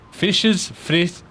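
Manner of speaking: attempted German. Shown as a demonstration. Streamer reading a German tongue twister aloud, attempted German. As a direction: have a go at German without knowing it.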